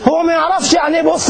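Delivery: high amused exclaim at the misunderstanding. Retold or imitated. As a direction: exclaim it as the joke lands. Amused retelling of Egyptian joke.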